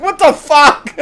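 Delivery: loud, very high disbelieving cry; mock shock. Waking up with robots on shoulders, mock shock. Imagined shocked reaction, after laughing.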